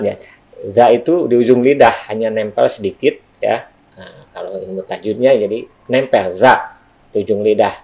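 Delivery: normal talking voice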